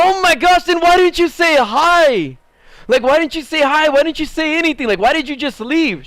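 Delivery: high-pitched, astonished string of questions; incredulous protest. Retold or imitated. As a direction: replay your disbelief, pleading for an explanation. Retold incredulous reproach to old friend.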